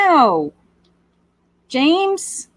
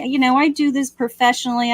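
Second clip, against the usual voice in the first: ordinary talk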